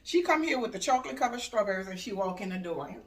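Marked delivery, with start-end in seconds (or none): none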